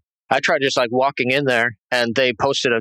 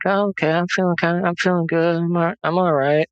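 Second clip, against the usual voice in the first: dazed wife; 5 semitones higher than usual. Retold or imitated.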